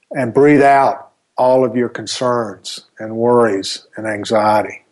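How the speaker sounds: calm, unhurried meditation voice; guided meditation. Acted. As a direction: ease the listener into calm.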